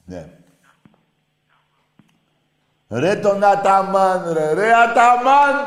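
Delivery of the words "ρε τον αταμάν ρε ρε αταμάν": slow, high, loud stadium call; drawn-out yell. Real, not acted. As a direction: bellow the name across the stands. Yelling a name like fans.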